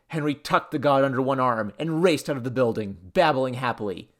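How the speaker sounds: ordinary delivery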